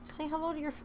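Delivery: squeaky coo at the pet; pet talk. Real, not acted. Owner prompting her pet for the fans, very high coo.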